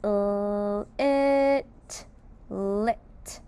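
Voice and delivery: L then it, then lit blended; sounding out lit. Shown as a demonstration. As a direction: split L from it and blend. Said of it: "Let's sound out lit".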